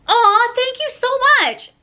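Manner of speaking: high, slow sugary thank-you; gushing thanks. Retold or imitated. To the merch guy, gushing high thanks. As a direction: gush sweetly like it's a gift. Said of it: "I was like".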